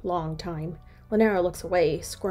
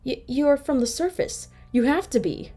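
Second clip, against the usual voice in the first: higher, shaken stammer; stuttering in shock; 7 semitones higher, 6 dB louder than usual. Acted. Arvosi, stammering in shock at seeing Lanera. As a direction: stumble over the first word in shock. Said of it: "Arvosi is stuttering".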